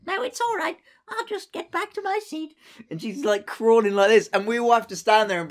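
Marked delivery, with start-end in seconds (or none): 0.0-2.5 put-on high, dignified posh old lady voice; imitating a tiny posh lady, much higher. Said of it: "she's still being really posh".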